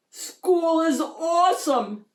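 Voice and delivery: high, slow excited shout. A narrator's reading. Shark pupil at school, shouting happily. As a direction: shout it out with joy. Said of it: "shouted Clark the shark".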